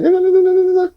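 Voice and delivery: very high, rapid "na-na-na" babble; mimicked yammering. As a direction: imitate someone yammering on in a squeaky pitch. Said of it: "I was making like".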